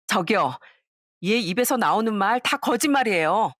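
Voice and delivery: lightly voiced sneering classmate, taunting; a hint of a spiteful old classmate gloating; mocking classmate. (살짝 연기한 비꼬는 동창 목소리)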